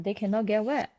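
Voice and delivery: ordinary delivery, nothing marked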